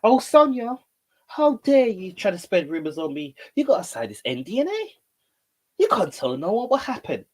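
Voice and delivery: 高亢做作的“how dare you”; mock indignant woman. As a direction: ham up an offended diva. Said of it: "mocking Sonya voice".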